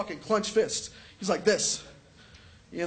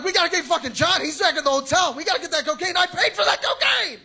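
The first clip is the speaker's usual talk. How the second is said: loud, high, frantic yelling; panicked shout; 8 semitones higher, 7 dB louder than usual. Retold or imitated. Comic panicking at friends to go get John, loud and frantic. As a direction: shout it like the cocaine is in danger.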